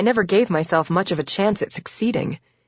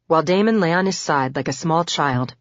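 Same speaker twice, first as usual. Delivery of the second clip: ordinary delivery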